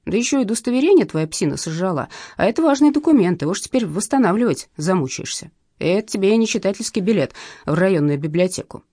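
ordinary delivery

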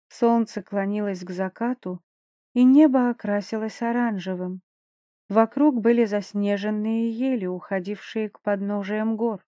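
usual speaking voice

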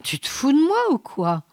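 captain grumbling that he is being fooled; grumbling disbelief. A narrator's reading. Captain suspects Alvor is pulling his leg, grumbled and incredulous. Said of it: "grommela le capitaine".

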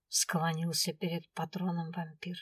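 ordinary delivery

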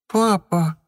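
put-on high plaintive child's cry; a toddler about to cry; tearful toddler. A narrator's reading. Toddler near tears.

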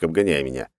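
usual speaking voice

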